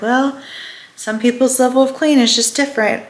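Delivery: a lightly voiced breezy agent excusing the mess; mock real estate agent. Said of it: "And she's like".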